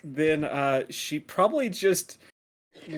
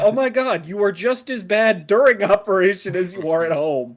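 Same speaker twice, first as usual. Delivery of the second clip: loud, high exasperated scolding; loud exasperated rant; 4 semitones higher, 11 dB louder than usual. Acted. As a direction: shout it in disbelief. Captain scolding crew, loud.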